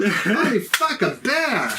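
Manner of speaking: high, slow mock exclamation; slow replayed shock. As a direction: drag out the shocked line. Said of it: "when you said it".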